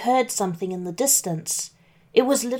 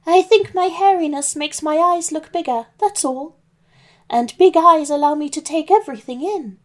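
put-on higher, louder wobbly old lady; old granny voice; 7 semitones higher, 4 dB louder than usual. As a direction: play the wolf faking a sweet granny. Wolf as Granny.

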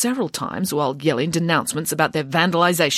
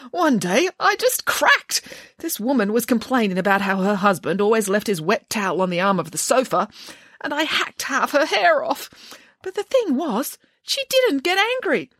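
high, self-pitying confession; weepy hairdresser; 8 semitones higher than usual. A narrator's reading. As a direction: confess with rising wonder.

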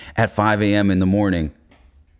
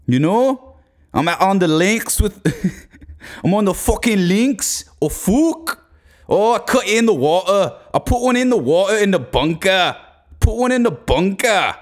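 high, hammed-up Irish golfer; mock Irish lad, voice pitched up; Irish accent; 9 semitones higher than usual. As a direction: do a dodgy Irish accent out on the course. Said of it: "if this is an Irish accent".

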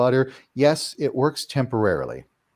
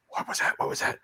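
gasp, then a hushed, mock-spooked "what was that"; startled gasp; 8 semitones higher, 5 dB quieter than usual. Scared homeowner hearing a ghost: hushed mock fright. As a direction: play startled at a noise in the house. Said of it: "you're gonna be like".

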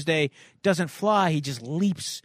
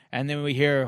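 ordinary talk, nothing marked

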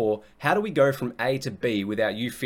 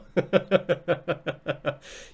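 laugh; 6 semitones higher than usual. Real, not acted. Laughs at own joke.

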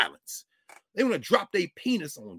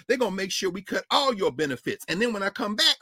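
normal talking voice

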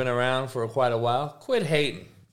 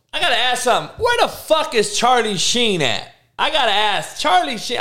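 high, keyed-up asking; excited demand; 10 semitones higher, 3 dB louder than usual. Real, not acted. To the podcast audience, asking where Charlie Sheen went, excited and high. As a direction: fire off the question, worked up.

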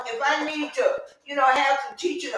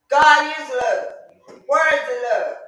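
preaching shout; 2 semitones higher, 4 dB louder, slower than usual. Real, not acted. Preacher proclaiming to the church, slow and loud. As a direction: hammer each word out slowly.

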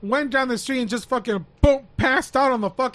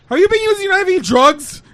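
higher and louder, blunt; blunt loud question; 7 semitones higher, 9 dB louder than usual. Retold or imitated.

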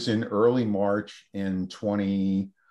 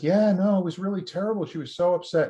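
imitating a distressed assistant, high-pitched; retold upset; 10 semitones higher, 4 dB louder, faster than usual. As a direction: relay it in a high, worked-up voice. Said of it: "came back from the morning treatment that she was doing in tears".

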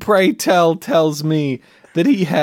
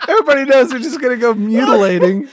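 laughing; 10 semitones higher, 3 dB louder, faster than usual. Real, not acted. Laughing through a silly line.

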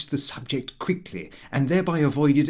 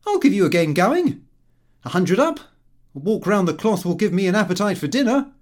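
louder, higher cocky challenge, fast at the end; cocky son offering billiards; brash young Lupin; 5 semitones higher, 5 dB louder, faster than usual. A narrator's reading. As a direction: throw down the challenge boldly.

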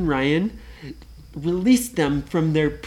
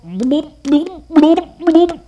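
rising cartoon bloop noises, high and loud; squelchy bloops with each step; sludge bloops; 12 semitones higher, 7 dB louder, much slower than usual. Pirate wading into the sludge, playful bloops.

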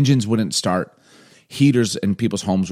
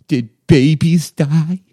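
put-on high, louder little-kid call, near tears; a three-year-old about to cry; quivering toddler voice; 8 semitones higher, 6 dB louder, much slower than usual. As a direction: son calls out, high and teary.

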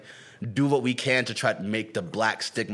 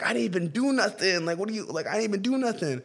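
higher-pitched bewildered denial, imitated; 8 semitones higher than usual. Voicing the rapper cursed at by a girl, protesting innocence. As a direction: mimic someone pleading innocence, pitch lifted. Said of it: "[name]'s sitting there like".

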